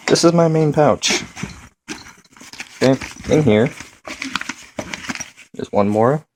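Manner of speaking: muffled voice, mouth covered. Real, not acted. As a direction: talk as if into the pack. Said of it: "I'm sorry if it sounds muffled here".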